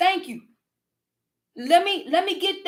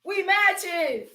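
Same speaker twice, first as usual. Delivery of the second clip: ordinary talk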